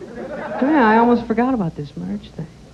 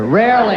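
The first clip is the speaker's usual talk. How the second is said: Rarely said again, louder and higher with a falling punch; stress on Rarely; 3 semitones higher, 5 dB louder than usual. Shown as a demonstration. Comic echoing his own line, punching Rarely.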